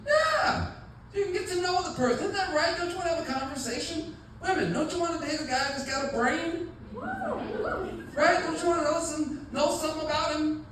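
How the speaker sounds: rally the women in the room; animated preaching. Real, not acted. Preaching to the audience.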